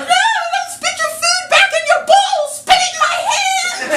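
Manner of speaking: high, loud, laughing yell; mock yell. Mother yelling at daughter spitting food, high and loud. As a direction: shout it up high in a panic.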